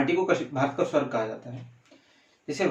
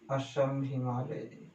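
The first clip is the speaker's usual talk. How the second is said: slow, softer, spelled-out dictation; 6 dB quieter, much slower than usual. Shown as a demonstration. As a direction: say it slowly so students can write. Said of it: "क्या बोलते हैं?".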